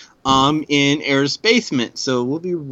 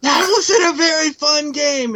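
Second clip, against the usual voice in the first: high-pitched imitation of a complaint; Tricky; 13 semitones higher, 4 dB louder than usual. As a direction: mimic the gamer's grievance way up high. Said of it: "Tricky going".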